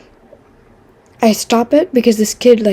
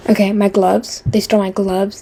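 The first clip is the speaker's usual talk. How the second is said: ordinary delivery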